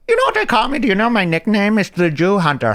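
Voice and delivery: a hint of a sly movie villain; retelling the film scene, light villain impression; Christoph Waltz. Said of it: "He goes".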